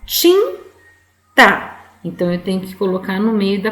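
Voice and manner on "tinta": "tinta" broken into tin, pause, ta; split into syllables. Shown as a demonstration. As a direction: say "tinta" as two high, loud syllables. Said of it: "a primeira sílaba". ("tinta" separado em sílabas: tin... ta)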